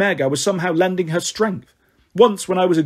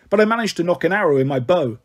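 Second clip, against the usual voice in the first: ordinary delivery, nothing marked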